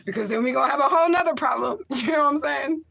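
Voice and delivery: high, loud, fast sobbing words; crying out through tears; real crying, voice cracking high. Real, not acted. Mother crying to her kids.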